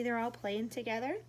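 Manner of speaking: usual speaking voice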